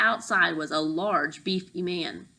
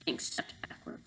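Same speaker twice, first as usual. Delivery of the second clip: ordinary delivery, nothing marked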